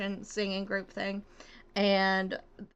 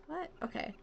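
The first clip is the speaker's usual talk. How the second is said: normal talking voice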